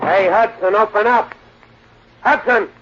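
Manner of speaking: high, loud yell through a locked door; shouting through door. Acted. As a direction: call him out through the door. Pounding on Hudson's locked door.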